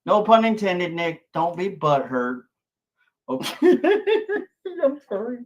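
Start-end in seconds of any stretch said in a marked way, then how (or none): 3.3-5.4 laughing through a high apology; laughing through pain. Real, not acted. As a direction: giggle through the sorry.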